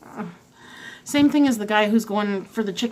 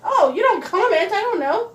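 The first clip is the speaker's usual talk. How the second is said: teasing excitement; 11 semitones higher, 5 dB louder than usual. Real, not acted. Teasing partner.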